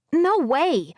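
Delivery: put-on high young boy's voice with a lisp; little boy with a lisp. A narrator's reading. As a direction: voice a small lisping kid. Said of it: "a younger boy with a bit of a lisp said".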